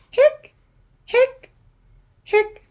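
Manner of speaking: high squeaky hic hic hic; squeaky hiccups. Acted. Skeleton hiccuping to the kids, high squeaky hics.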